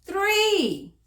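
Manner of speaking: high, loud triumphant "Three"; cheery answer. Acted. Teacher to children counting buttons, bright loud answer. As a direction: announce the answer proudly to kids.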